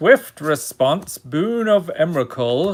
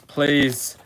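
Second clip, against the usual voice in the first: ordinary talk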